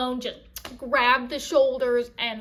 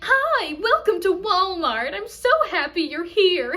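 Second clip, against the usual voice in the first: high, loud gushing greeter welcome; crazily chirpy Walmart greeter impression; over-happy store greeter; 4 semitones higher, 6 dB louder, slower than usual. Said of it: "too happy to work at Walmart".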